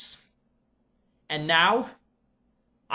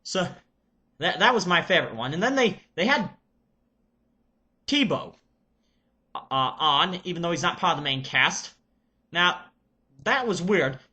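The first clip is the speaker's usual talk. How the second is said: put-on Serbian accent. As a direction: play an excited Serbian reviewer character. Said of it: "I shall get into character".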